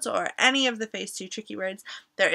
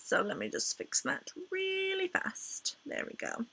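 hushed aside whisper; 8 semitones higher, 11 dB quieter than usual. Real, not acted. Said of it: "whispered aside".

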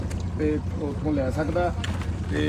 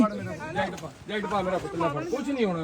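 gentle coaxing; 8 dB quieter than usual. Real, not acted. Rescuer tells scared child to put on jacket, soft and slow. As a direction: ease the little one into the jacket, softly.

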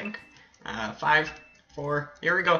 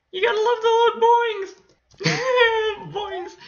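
very high, louder, quick squeal; gleeful squeal; 4 dB louder than usual. Pretend. Gamer gushing over the little boings, gleeful squeal.

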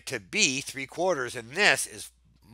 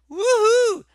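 woohoo cheer; 7 dB louder than usual. Real, not acted. Celebrating solved problem.

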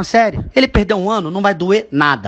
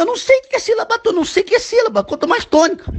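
exasperated outburst; 12 semitones higher than usual. Real, not acted. Father fed up with son's online homework, exasperated high-pitched protest. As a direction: pitch jumps way up in disbelief.

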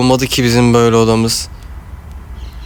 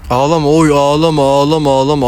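soothing hush. Acted. Soothing crying baby.

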